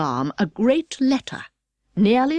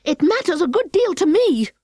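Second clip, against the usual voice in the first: a touch hasty; 9 semitones higher than usual. Acted. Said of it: "said Alice hastily".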